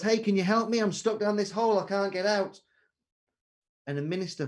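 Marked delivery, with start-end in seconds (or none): 0.0-2.6 higher, louder call up out of the hole; a man stuck in a hole calls to a stranger, higher and louder acted shout; acted shout for help. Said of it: "and he shouts".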